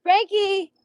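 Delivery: high, very loud holler; calling out. Real, not acted. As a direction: holler the horse's name across the yard. Calling out to the horse.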